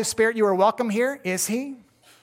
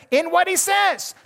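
In what he says, pitched much higher and punched louder; stress on what he says; 10 semitones higher, 4 dB louder than usual. Shown as a demonstration. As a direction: hammer home what he says. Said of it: "notice what he says".

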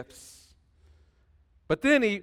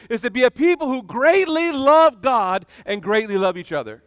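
preaching at full force; 6 semitones higher, 7 dB louder than usual. Real, not acted. Preaching climax to the congregation.

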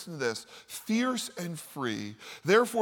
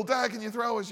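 high, eager ask; lively mock child asking; excited kid; 6 semitones higher than usual. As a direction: ask dad with a grin. Kids asking at the pool.

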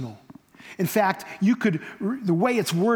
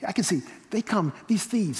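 ordinary talk

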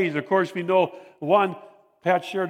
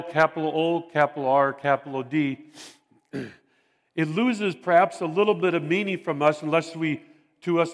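normal talking voice

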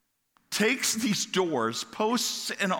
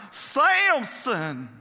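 a hammed-up southern twang calling "Samson!" high and loud; mock southern accent, Delilah calling out "Samson!"; 8 semitones higher, 4 dB louder than usual. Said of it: "in the southern accent".

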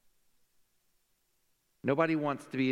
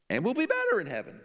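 voice cracking; 10 semitones higher than usual. Real, not acted. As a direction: voice cracks up high. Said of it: "My voice just cracked".

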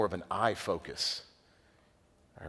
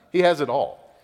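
ordinary delivery

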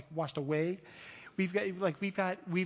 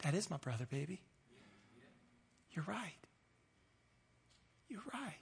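tender reply to daughter; 2 semitones lower, 12 dB quieter than usual. Retold or imitated. Father answering his little daughter, gently and softly. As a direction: soothe her like a father. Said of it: "I was like".

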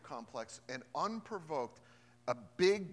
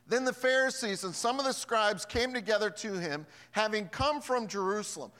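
scripture reading; 6 semitones higher, 8 dB louder than usual. Acted. Reads scripture.

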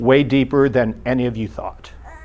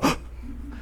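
soft gasp; 12 dB quieter than usual. Retold or imitated. Person stunned by God's answer.

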